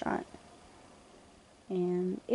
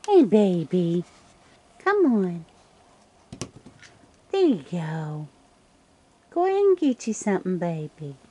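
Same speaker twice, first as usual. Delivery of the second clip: coaxing; 4 dB louder than usual. Real, not acted. Coaxing a raccoon named TJ.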